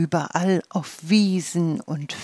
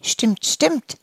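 higher, eager little cry of agreement; calling out; 8 semitones higher than usual. Acted. Dandelions calling out in agreement, higher and eager. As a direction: chime in eagerly, pitched up. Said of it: "riefen einige Pusteblumen".

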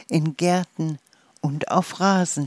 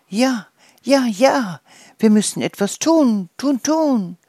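higher, louder eager shouting; rally the meadow, loud and pitched up; loud chorus; 6 semitones higher, 5 dB louder than usual. Acted. The dandelions shouting across the meadow, loud and eager. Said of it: "laut hallte es über die Wiese".

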